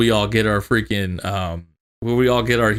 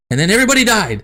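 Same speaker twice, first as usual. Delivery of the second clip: retold higher-pitched blurt of the ending; mom's blunt spoiler; 9 semitones higher, 4 dB louder, much faster than usual. Mom on the phone spoils the movie ending, blurted out. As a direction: imitate mom blurting out the twist.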